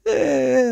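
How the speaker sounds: a sudden high grunt; high, sharp "Eh"; mock coked-up grunt. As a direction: grunt it out like the manager coming out of the toilets. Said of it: "like this".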